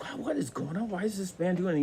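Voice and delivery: high, soft, bewildered thought; puzzled alarm, kept small; thinking to himself under water, high and soft with worry; confused panic. Retold or imitated. Man held under water, panicking.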